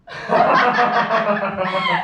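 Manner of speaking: a long, loud, rising laugh; loud social laugh. Acted. Amanda charming the gentleman caller with a loud rising laugh. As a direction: trill out a gracious laugh that climbs.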